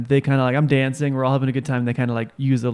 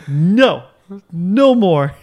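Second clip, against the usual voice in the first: loud, raised-pitch acted protest; firm mock refusal; 5 semitones higher, 6 dB louder, much slower than usual. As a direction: put your foot down loudly, hammed-up. Acting out fending off attackers.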